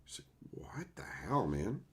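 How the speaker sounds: hushed, voiceless disbelief; exasperated whisper. Real, not acted. Exasperated aside about a bad show.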